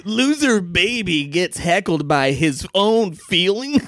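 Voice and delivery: high, slow hammed-up announcement; mocking headline voice. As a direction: proclaim a ridiculous video title, stretching every word. Mock viral video headline.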